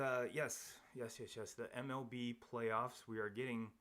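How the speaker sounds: ordinary talk, nothing marked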